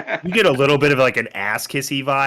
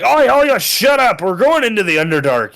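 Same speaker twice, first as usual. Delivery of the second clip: louder and much higher; called out; 10 semitones higher, 6 dB louder than usual. Acted. Lord's Alliance member yelling at the party to shut up. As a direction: snap out a sharp shushing yell. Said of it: "immediately just called out".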